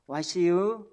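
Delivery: loud Chungcheong dialect 와시유, called out; Chungcheong greeting. Shown as a demonstration. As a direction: belt out 와시유 like a Chungcheong local. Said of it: "충청도 사람 어떻게 인사하는지 아세요?". (충청도 사투리로 크게 "와시유?")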